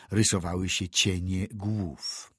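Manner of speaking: usual speaking voice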